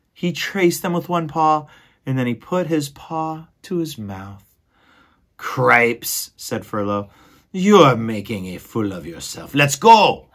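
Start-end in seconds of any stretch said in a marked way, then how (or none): 5.4-10.3 exasperated mouse brother. Acted. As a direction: huff it out as the fed-up brother. Furlow impatient.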